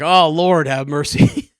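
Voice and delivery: a loud, high "Lord have mercy" at the sight of his son; overwhelmed exclaim. Real, not acted. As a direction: let it burst out, half laughing. Overwhelmed watching son and wife.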